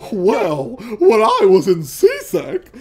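high, loud foppish dandy voice; affected fluting fop. Shown as a demonstration. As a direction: flounce it like a dandy. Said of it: "do, like, a really foppish voice?".

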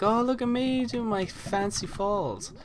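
high, slow self-mocking sing-song; mock showing off. Player mocking his own failed fall, high show-off voice. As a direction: parody yourself showing off, drawn-out and high. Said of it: "Me trying to be all cool".

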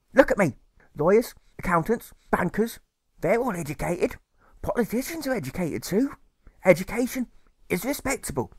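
high, fretful mother's voice; fussy mother. Acted. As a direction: nag him like a doting, anxious mother. Mother's long speech voiced.

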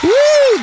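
high, loud "woo"; excited whoop. Real, not acted. Host opening the show with her guest, excited whoop. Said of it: "that is exciting".

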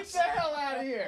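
high, incredulous outburst; disbelieving. Real, not acted. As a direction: shoot up in disbelief. Disbelief at thimble news.

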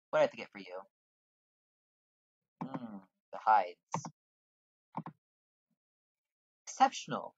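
a hint of a pleased fur trader; guild merchant. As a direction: read the trader's lines with mild relish. Reads NPC guild dialogue aloud.

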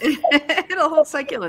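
slow, high, laughing repeat; laughing echo. Real, not acted. Cohost laughing while repeating the guest's punchline. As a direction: savour the line through laughter.

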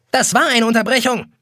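high-pitched indignant screech. A narrator's reading. Yellow clock snapping at Jonas for interrupting, high screech. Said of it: "kreischt ihn eine gelbe Uhr an".

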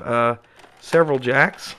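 usual speaking voice

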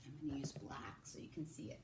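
whispered aside. Real, not acted. Aside while writing on the board.

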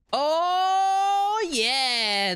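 long, high, drawn-out "oh" easing down; excited cheer. Real, not acted. Player celebrating opened loot, excited. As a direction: belt out a delighted whoop. Celebrates cracking everything open.

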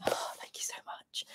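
hushed, very soft gratitude; barely voiced, relieved thank-you; whispered thanks. Real, not acted. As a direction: whisper your thanks under your breath. Relieved thanks for accent praise.

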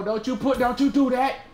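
high, loud pleading yell; real alarm rising into a shout; panicked shout. Real, not acted. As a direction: yell at the enemy to stop. Enemy attacking him mid-game.

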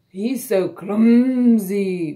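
very slow, loud lowing cow voice; mooing cow. A narrator's reading. Cow sneering at the clumsy duckling, slow mooing. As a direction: moo the words out long and heavy. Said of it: "mooed the cow".

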